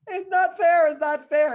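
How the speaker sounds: high, loud whining protest; hammed-up wailing child; mock tantrum. Daughter protests she is not getting it, mock tantrum.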